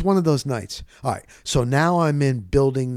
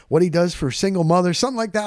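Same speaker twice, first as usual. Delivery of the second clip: ordinary talk, nothing marked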